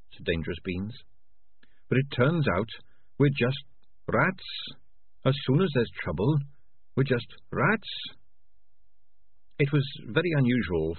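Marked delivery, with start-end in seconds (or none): 1.9-7.9 higher, earnest little rat voice; higher-pitched rat character; dejected earnest rat. Acted. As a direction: voice a disillusioned small rat, lifting the pitch. Voices the rat Dangerous Beans.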